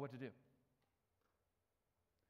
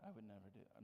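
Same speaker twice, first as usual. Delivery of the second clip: much softer repeat of never do that, fading away; mock denial, trailing off; 6 semitones lower, 14 dB quieter, faster than usual. Imitating a listener again, now much softer and fading. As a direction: let the smug denial fade to a murmur. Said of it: "some of you are like".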